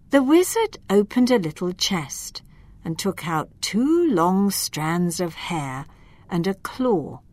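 ordinary delivery, nothing marked

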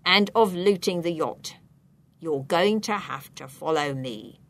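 low, stern official voice; accusing policeman. A narrator's reading. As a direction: deliver the accusation with authority.

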